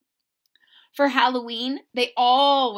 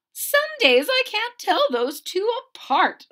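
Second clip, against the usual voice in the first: much higher, louder marveling mom voice; a mother laughing at how alike they are; put-on bemused mother; Wilson's mother; 11 semitones higher, 6 dB louder than usual. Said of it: "said Wilson's mother".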